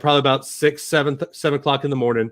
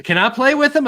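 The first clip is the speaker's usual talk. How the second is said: put-on child's voice, pitched much higher, pleading; eager child asking; 11 semitones higher than usual. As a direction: ask it like an excited little girl wanting to play. Daughters asking to play with toys.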